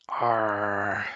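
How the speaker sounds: a hearty pirate bark of arr; loud pirate arr. Pretend. Pirate grunt, louder.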